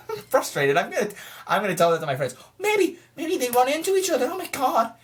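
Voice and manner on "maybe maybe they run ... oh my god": much higher, giddy fan excitement; excited. Real, not acted. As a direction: squeal with excitement.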